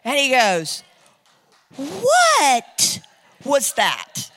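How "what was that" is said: hammed-up stunned disbelief; imitating astonished man. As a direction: gape at a sudden miracle. Astonished man reacts to the miracle.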